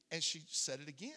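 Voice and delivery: normal talking voice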